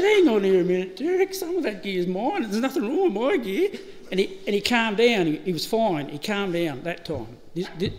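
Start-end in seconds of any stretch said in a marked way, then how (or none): 0.0-3.7 louder, higher indignant objection; protesting. Retold or imitated. Narrator defends his gear to Derek, raised protest. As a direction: push back hard on Derek.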